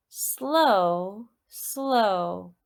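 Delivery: normal talking voice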